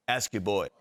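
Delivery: low, cool one-liner. Retold or imitated. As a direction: drop it low and offhand. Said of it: "and he goes".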